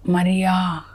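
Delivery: quiet, gentle call of her name; Jesus calling softly. Acted. Jesus calls Mary by name.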